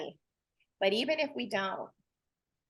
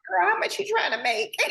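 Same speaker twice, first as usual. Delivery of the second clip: laughing retold question; 8 semitones higher than usual. Boss's offer retold laughing.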